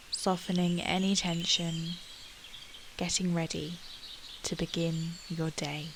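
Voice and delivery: normal talking voice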